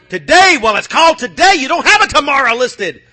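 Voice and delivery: loud, high urgent warning; loud preaching warning about today; urgent shout. Real, not acted. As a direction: press hard on the congregation.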